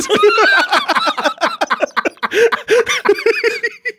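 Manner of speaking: big high-pitched burst of laughter; cracking up at her own joke; long high laugh. Real, not acted.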